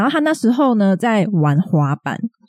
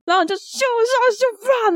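whoosh sound; slower than usual. Retold or imitated. Imitating skateboard whoosh.

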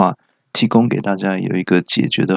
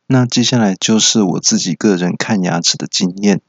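normal talking voice

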